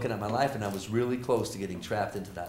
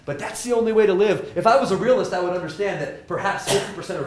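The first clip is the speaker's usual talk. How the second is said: much higher and louder, fired up; passionate; 9 semitones higher, 9 dB louder than usual. Real, not acted. Professor urging students, fired up and loud. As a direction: push the point with conviction.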